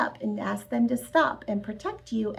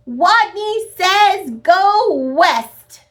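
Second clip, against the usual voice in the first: higher, loud and slow; strong voice; 8 semitones higher, 8 dB louder, much slower than usual. Acted. Wadney giving the last command, high, loud and slow. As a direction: boom it out slowly. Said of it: "In a voice so strong".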